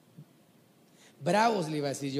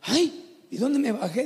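put-on startled girl; 7 semitones higher, 4 dB louder, slower than usual.

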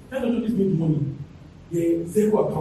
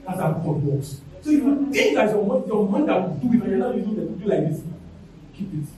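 ordinary talk

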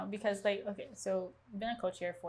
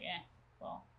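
soft, half-voiced shrug; mock indifference; 7 dB quieter than usual. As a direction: imitate someone who can't be bothered, softly. Acts out unmotivated team members.

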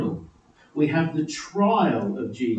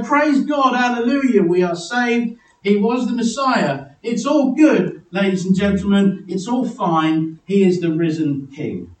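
higher, louder mock celebration; imagined jubilant praise; 5 semitones higher, 5 dB louder than usual. Preacher imagining the disciples celebrating, mock jubilant. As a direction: exclaim it like a triumphant hallelujah, settling at the end. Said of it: "lift their hands in the air and go".

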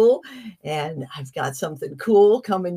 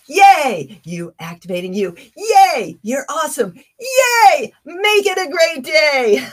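high loud cheerful yays; excited cheering; 8 semitones higher, 8 dB louder than usual. Real, not acted. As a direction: cheer them off with big energy.

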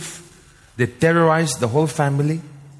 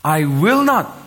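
"I will not" said with full, drawn-out force; strongest emphasis; 3 semitones higher, 4 dB louder, slower than usual. Shown as a demonstration. Preacher showing how God promises, strongly emphatic. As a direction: land "I will not" as hard as possible. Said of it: "making the emphasis in the strongest way".

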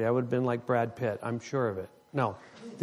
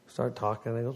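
ordinary talk, nothing marked

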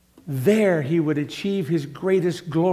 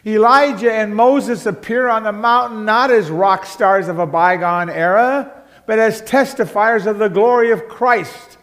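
rising, louder sermon delivery, pressing each point home; preaching at full force; 4 semitones higher, 5 dB louder than usual. Acted. Pastor to congregation on the transfiguration, impassioned preaching. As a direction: proclaim it to the congregation.

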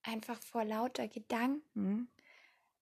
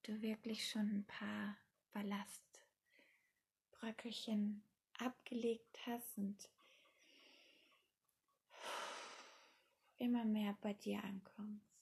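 guided meditation; 8 dB quieter than usual. Acted. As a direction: ease the listener into calm, softly.

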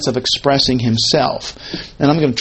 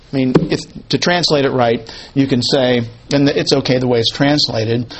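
normal talking voice

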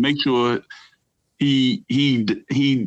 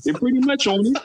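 a much higher mocking echo; high squeaky repeat; 12 semitones higher than usual. Repeats phrase, second time voiced.

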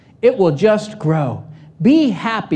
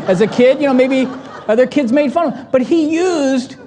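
ordinary talk

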